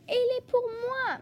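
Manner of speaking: high-pitched happy cry; overjoyed rabbit getting a present; delighted exclamation. Acted. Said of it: "s'écrie-t-il".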